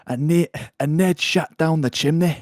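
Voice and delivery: P=A; Scottish accent. Acted. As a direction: read it like the Scottish homeowner. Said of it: "he is Scottish".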